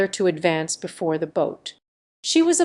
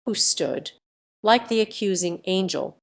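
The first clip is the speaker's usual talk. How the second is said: usual speaking voice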